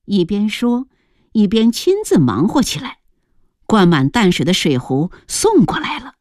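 ordinary delivery

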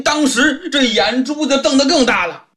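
ordinary delivery, nothing marked

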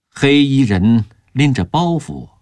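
ordinary delivery

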